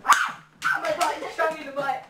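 loud high cry of pain; yelp, just shot in the butt; pained yell. Real, not acted.

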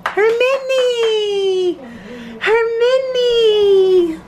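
high sing-song calling out; calling the dog in a squeaky pet voice. Real, not acted. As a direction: croon the name way up high. Calling the dog new name.

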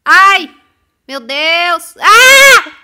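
high loud yelps into a shriek; panicked game scream. Real, not acted. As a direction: cry out as the character dies. Screaming as character dies in game.

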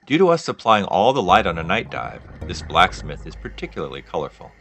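normal talking voice

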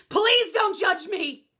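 loud, slow plea; loud mock plea; pleading outburst. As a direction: beg the audience not to judge. Comic plea.

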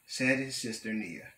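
plain reading voice

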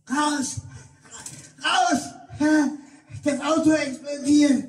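hoch gespielter Alarmruf; panischer Schrei. Retold or imitated. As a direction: schrei alle aus dem Auto. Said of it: "hörte ich Sonja schreien". (Sonja schreit panisch, alle sollen raus)

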